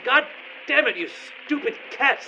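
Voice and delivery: high, exasperated cursing at the cat; furious curse flung at a silent cat; angry outburst. Acted. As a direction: blow up at a useless cat. Cursing the cat mid-crisis.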